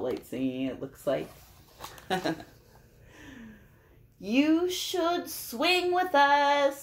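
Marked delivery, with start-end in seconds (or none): 4.2-6.8 high, loud, slow monkey call; swinging monkey invitation; playful monkey voice. Acted. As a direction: sing it out like a bouncy monkey.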